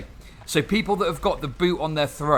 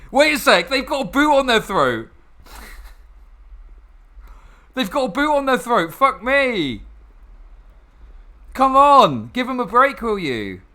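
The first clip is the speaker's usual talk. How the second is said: higher, louder incredulous outburst; exasperated rant; 7 semitones higher, 4 dB louder than usual. Real, not acted. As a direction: plead it in disbelief. Exasperated rant about moralizing.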